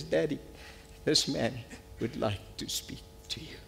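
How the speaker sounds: halting, overcome, voice dropping away to almost nothing; choked with emotion. Real, not acted. Son presents you at the Father's throne, choked with emotion. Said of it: "I get emotional about this".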